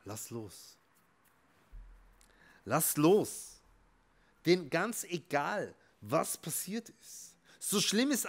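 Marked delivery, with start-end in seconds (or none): 0.0-0.8 "Lass los" said softly and low; "Lass los" sussurrato piano. Shown as a demonstration. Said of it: "Du musst einfach nur loslassen". 2.6-3.6 "Lass los" said loud and firm; "Lass los" detto forte e deciso. Shown as a demonstration. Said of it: "Du musst einfach nur loslassen".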